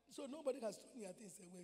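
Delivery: normal talking voice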